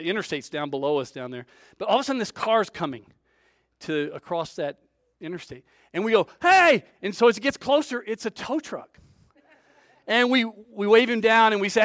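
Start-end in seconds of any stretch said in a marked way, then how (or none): 6.4-6.8 high, loud hail; calling out. Retold or imitated. Stranded men shout to hail the passing tow truck, loud. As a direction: flag him down with a shout.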